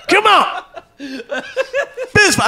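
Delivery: laughing squeaky dare; wife's taunt retold, laughing high; high mock taunt, laughing. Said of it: "The Don voice".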